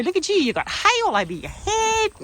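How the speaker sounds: high cooing pet talk; high, doting voice to a messy horse. Real, not acted. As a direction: coo at him like a fond owner. Cooing at horse with hay on head.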